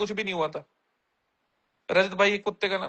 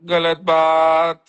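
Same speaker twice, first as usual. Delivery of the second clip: drawn-out, louder mock scolding from a mum; slow, loud gentle telling-off as a mother; mother scolding a child; 4 semitones lower, 5 dB louder, much slower than usual. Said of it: "show में शिवेत को उसकी mummy".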